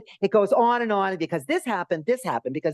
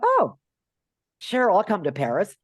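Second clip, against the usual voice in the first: mock delight; 3 semitones higher than usual. Accepting the Paris invitation.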